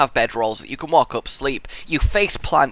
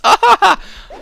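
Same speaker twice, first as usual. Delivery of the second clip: high excited laugh; 9 dB louder than usual. Real, not acted. Intense fight.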